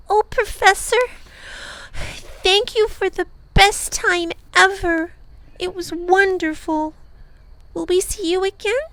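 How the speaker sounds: put-on an excited child hugging her friend; grateful girl. Lindy thanks the professor for the best time ever, gushing.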